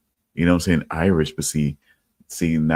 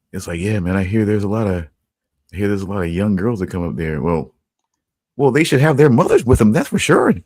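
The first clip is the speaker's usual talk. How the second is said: put-on Midwestern mom accent. Complaining about girls without mothers in a Midwestern mom accent.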